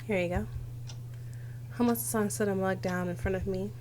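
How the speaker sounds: normal talking voice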